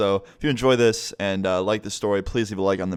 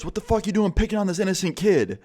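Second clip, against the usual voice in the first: much higher, outraged call at the chaser; indignant yell; 10 semitones higher than usual. Retold or imitated. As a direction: scold the attacker like a protective stranger. Segway guy defends the kid.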